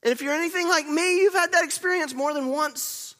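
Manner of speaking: loud, high-pitched preacher; full-force preaching. Real, not acted. Preacher telling church they've had that experience, full-force preaching. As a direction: preach it out to the whole room.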